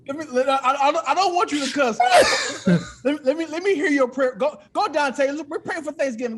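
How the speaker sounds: cracking up while begging him to pray; laughing, egging on. Real, not acted. Laughing host urges his guest Dante to pray.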